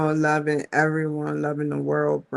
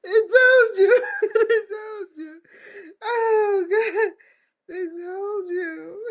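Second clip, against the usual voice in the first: higher, breaking up in laughter; can't get the words out for laughing; real laughing; slower than usual. Real, not acted. Cracking up at her own joke for viewers, real laughter.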